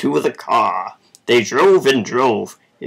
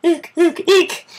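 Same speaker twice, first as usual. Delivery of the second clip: high monkey squeaks; playful monkey eek. Acted. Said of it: "but more of an".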